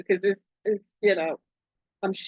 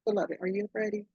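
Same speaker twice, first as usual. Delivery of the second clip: usual speaking voice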